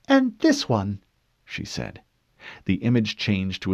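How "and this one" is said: cool female interrogator. Acted.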